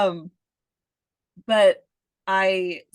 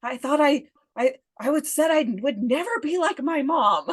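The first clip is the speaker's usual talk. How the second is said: higher, lightly voiced mom; mother's voice coming out; 7 semitones higher than usual. A parent hearing her own mother come out of her mouth, mock mom voice. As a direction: let your mother speak through you. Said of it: "your mother comes out and it's like".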